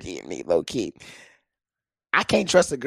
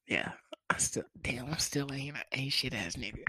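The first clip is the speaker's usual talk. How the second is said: hushed self-mocking whisper; whispered aside; 13 dB quieter than usual. Real, not acted. Comic muttering to himself about his own flaws, in a whisper. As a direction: breathe it as a secret confession.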